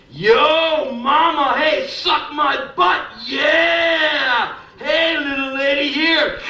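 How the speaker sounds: high, loud hollering catcall, hammed up; mock the guys on the girders yelling down; construction worker catcalls. Construction workers catcalling a woman below, loud mocking holler. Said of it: "these guys start up".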